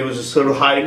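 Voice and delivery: ordinary talk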